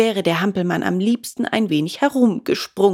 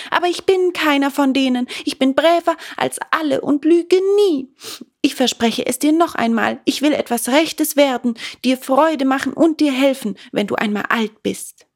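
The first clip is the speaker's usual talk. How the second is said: put-on high, eager wooden boy begging; pleading puppet; 7 semitones higher than usual. A narrator's reading. Puppet begging father for new feet, high and eager. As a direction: plead earnestly as the little puppet. Puppet insisting he's good.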